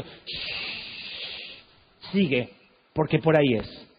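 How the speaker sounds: God gently hushing his servant; voz de Dios. Retold or imitated. (voz de Dios, chistido suave y orden serena más grave)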